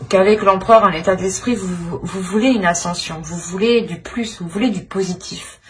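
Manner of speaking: ordinary delivery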